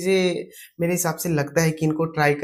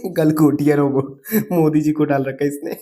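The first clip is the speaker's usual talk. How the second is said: laughing; 6 dB louder than usual. Real, not acted. Laughs reading out a comment.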